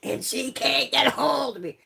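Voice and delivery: panicked, slowed then rising in pitch; retold frantic yelling about her mother; frantic outburst. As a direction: imitate losing it over a missing phone. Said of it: "start losing my fucking shit".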